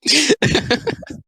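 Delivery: very high giggling fit; real laugh. Real, not acted. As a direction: crack up at your own joke. Laughs at own crude joke.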